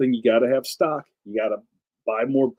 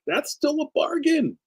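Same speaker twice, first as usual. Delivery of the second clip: much higher, light mock retort; 13 semitones higher than usual. Answering comic artists griping, high breezy retort. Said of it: "I'm like".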